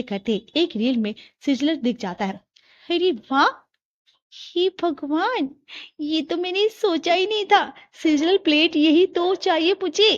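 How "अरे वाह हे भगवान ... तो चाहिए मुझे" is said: amazed delight. Acted. Priya spotting a sizzler plate on her phone, amazed. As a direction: marvel at a lucky find in a high voice.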